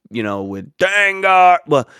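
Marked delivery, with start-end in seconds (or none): 0.8-1.6 higher, louder battle-cry imitation; mock war cry; imitated Ewok yell. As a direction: call out Dengar like a charging Ewok. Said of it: "the Ewoks yell".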